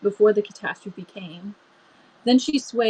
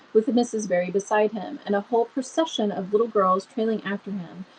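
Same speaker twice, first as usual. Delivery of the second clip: ordinary delivery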